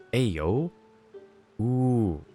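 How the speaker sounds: normal talking voice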